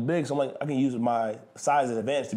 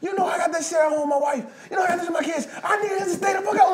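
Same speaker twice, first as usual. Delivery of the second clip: high, loud desperate outburst; blurting shout; 5 dB louder than usual. Retold or imitated. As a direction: let it all spill out in a shouted rant. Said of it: "he blurted out and said".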